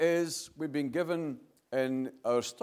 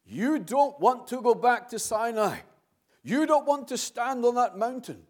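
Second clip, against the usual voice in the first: higher, louder preaching that swells on each point; 4 semitones higher, 3 dB louder than usual. Acted. Preacher urging the brethren toward Mount Zion in a rising preaching voice. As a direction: drive the contrast home from the pulpit.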